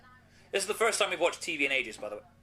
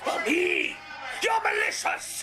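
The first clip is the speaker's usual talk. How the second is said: high, loud ranting villain voice; Zeb Colter impression; 6 semitones higher, 5 dB louder than usual. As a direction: shout it like a cartoon bad guy. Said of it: "it's my impression of Zeb Culture there".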